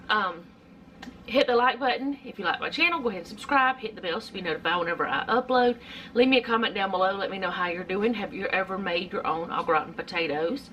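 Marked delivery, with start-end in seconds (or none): 1.3-10.7 fast, breezy string of requests without stopping; rapid rattle. Real, not acted. Vlogger asking viewers to like and subscribe, rattled off fast. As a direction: run the sign-off requests together quickly. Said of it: "I said that so fast together".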